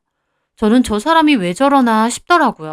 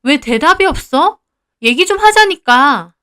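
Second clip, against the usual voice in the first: raised, higher-pitched insistence; exasperated demand; 5 semitones higher, 4 dB louder than usual. Acted. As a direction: press a silent partner for an answer. Pressing silent boyfriend to talk. (답답해서 다그치는 말투)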